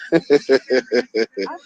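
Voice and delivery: low, pulsing chuckle; a string of low, even laughs; mocking chuckle. Real, not acted. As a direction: chuckle derisively at the rumor. Laughs at what somebody said.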